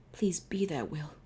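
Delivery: hushed pleading whisper. Acted. Pharaoh whispering hopefully while searching for Will. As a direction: whisper a desperate hope. Character hopes friend is there.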